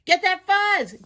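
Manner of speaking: high, loud rallying yell; excited attack shout; battle cry. Acted. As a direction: yell it quickly as a charge order. Fuzz fight breaks out.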